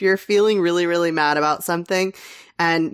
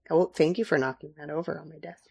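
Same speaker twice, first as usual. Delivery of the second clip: ordinary talk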